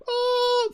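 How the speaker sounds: high held "ohh", an imitation of a film monster; high mock wail; imitated alien shriek. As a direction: mimic the creature's drawn-out high cry. Imitates the film's pointing scream.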